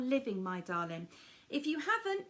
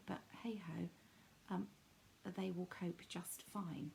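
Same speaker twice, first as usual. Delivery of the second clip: hushed conspiratorial aside; whispered aside; 5 semitones lower, 11 dB quieter than usual. Real, not acted. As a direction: breathe it as a private aside. Aside about skipping sisters' event.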